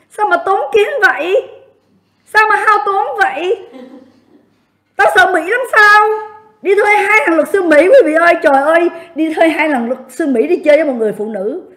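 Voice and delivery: high-pitched, scornful incredulity; mocking disbelief. Real, not acted. Streamer ridicules rival for hiring two American lawyers, mocking disbelief.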